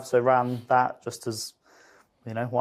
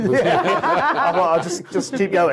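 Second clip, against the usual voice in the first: laughing; 6 semitones higher, 3 dB louder than usual. Real, not acted. Laughing about running.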